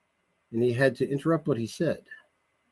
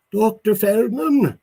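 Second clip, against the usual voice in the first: put-on slow, higher unusual English accent; 11 semitones higher, 7 dB louder, slower than usual. Englishman greeting Feldman at his door, unusual English accent. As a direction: draw out the name in an odd English accent. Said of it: "in an unusual English accent".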